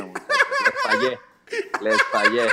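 squeaky high laughing apology; giggling through the words, voice cracking up. Real, not acted. Host apologizing to listeners, laughing too hard to speak. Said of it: "no lo pude decir sin dejar de reírme".

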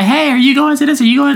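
high, chirpy acted asking; imitated hopeful questioning; eager invitation. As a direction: pitch it up as if pestering friends to come. Acts out asking friends along.

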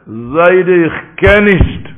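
loud, slowly stretched refusal; drawn-out refusal. Acted. Grandson refusing the money, loud and drawn out. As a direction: hold firm and stretch the words.

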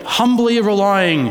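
slow, loud start of a formal reading; a tentative stately reading, slowly; first try at a constitutional voice. Shown as a demonstration. Preacher starts reading the Constitution, slow and grand. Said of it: "I should say it in a sort of constitutional voice".

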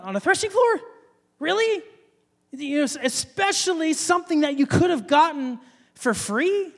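a mocking Really? aimed at David; mock disbelief. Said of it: "we think".

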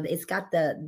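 ordinary delivery

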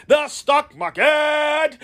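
slow, drawn-out shout for the crowd to repeat; call-and-response shout. Shown as a demonstration. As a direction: belt it out slowly so they say it with you. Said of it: "Can you say it with me?".

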